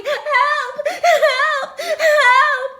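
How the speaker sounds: high, loud mock cries of help; shrieking help, hammed up; high shout for help. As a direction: squeal for help like a fleeing girl. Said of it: "she runs off shouting".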